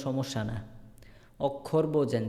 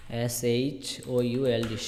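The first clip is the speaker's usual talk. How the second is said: spelling out letters; much slower than usual. Shown as a demonstration. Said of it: "আমি আরেকটা শব্দ লিখি এখন".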